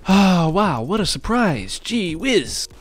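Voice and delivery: high, loud sarcastic amazement; mock surprise. Real, not acted. Player reacting to an unwelcome but expected turn, sarcastic mock surprise.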